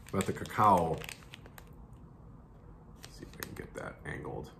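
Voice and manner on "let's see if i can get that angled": whispered aside. Real, not acted. Aside while handling camera.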